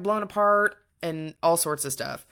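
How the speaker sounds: ordinary talk, nothing marked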